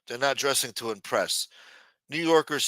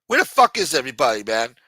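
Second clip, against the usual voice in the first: exasperated shout; 8 dB louder than usual. Real, not acted. Complaining about empty room.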